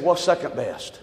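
low, soft deadpan comeback; deadpan punchline. Retold or imitated. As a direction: drop it flat and low for the laugh.

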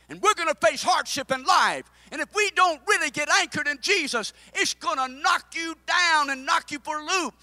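loud, high fervent preaching; preaching at full force. Acted. Preacher to congregation, loud fervent preaching. Preacher quoting Job loudly.

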